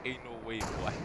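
quiet disbelief. Real, not acted. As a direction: say it softly to yourself. Same words after "never mind.

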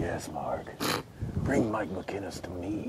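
very soft, hushed play-acting; mock summoning. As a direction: summon softly, as a joke. Mock-mysterious voice, playing along.

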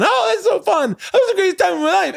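squealed falsetto, giddy and giggling; effeminate mocking impression. Workman mocking the comedian to his mates, effeminate impression. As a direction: gush in a very high, mock-effeminate voice. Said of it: "doing an impression of me going".

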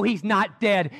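usual speaking voice

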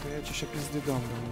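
ordinary talk, nothing marked

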